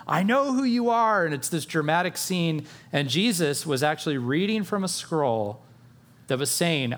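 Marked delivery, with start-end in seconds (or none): 0.0-1.2 a higher, louder mock yell; demon's yell. The possessed man yelling at Jesus in a higher, louder mock yell. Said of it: "and yelled".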